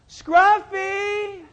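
loud, high, drawn-out call; calling the dog. Retold or imitated. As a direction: call out across the neighborhood for a lost dog. Acting out calling the lost dog.

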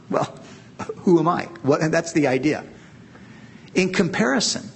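ordinary delivery

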